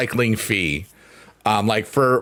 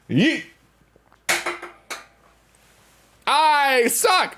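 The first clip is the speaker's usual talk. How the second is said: a high, sudden yelp as the can flies; excited yell; much slower than usual. Real, not acted. Throwing a can on stream, high yelp. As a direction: yelp high, then groan at the miss.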